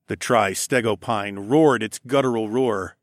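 ordinary delivery, nothing marked

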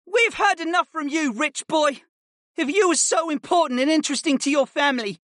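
high-pitched indignant student; sustained high voice, telling off a classmate; angry boy, spitting. A narrator's reading. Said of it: "Simon spat".

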